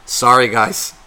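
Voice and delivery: usual speaking voice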